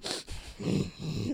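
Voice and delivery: snorting breaths like an angry bull; bull-like huffing. Retold or imitated. Retelling an upset man in the elevator, bull-like snorts. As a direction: huff hard through the nose, imitating a bull. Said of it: "like, like a bull".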